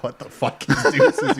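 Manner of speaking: slow, laughing disbelief. Retold or imitated. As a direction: laugh through the question. Laughing at unknown song.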